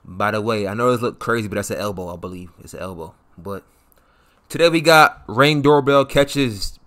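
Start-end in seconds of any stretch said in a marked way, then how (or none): none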